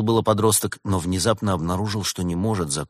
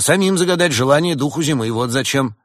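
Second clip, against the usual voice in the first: high, loud retort; heated accusation; 7 semitones higher, 5 dB louder than usual. Acted. Badger accuses the innkeepers, heated. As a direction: throw the accusation back, voice raised. Flustered businessman accuses landlady.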